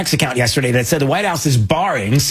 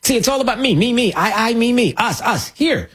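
higher, mock me-me-me whining; mocking self-importance; 7 semitones higher than usual. Host mocking a self-absorbed network, mock self-importance. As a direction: ape their self-centred clamour.

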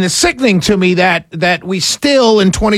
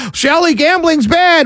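mocking voice; 10 semitones higher than usual. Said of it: "I bet you a bunch of y'all".

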